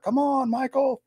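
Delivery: high mock taunting call; high, drawn-out coaxing call; calling Michael. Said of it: "we're all laughing and like".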